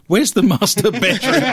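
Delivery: breaking up with laughter mid-quote; laughing. Retold or imitated. Host retelling colleague getting lost, laughing.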